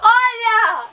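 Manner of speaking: loud high teasing shout; shouting, laughing. Real, not acted. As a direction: yell it at her with a laugh. Forcing grandma to watch.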